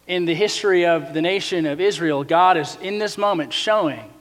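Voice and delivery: ordinary talk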